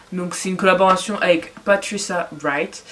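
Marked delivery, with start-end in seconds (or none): 1.6-2.8 name said in a playful English accent; hammed-up English accent; exaggerated English accent. Shown as a demonstration. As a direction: lay on the English accent for fun. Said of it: "l'accent anglais bien abusé".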